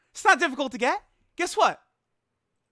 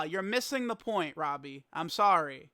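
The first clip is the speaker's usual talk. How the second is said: ordinary delivery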